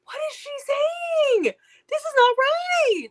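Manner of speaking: high and slow, baffled at the narrator; incredulous. Retold or imitated. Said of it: "And I'm like".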